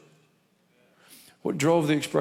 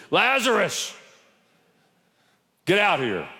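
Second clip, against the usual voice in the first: booming call; 6 semitones higher than usual. Shown as a demonstration. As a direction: call the dead man out to startle the church. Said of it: "I want to scare you".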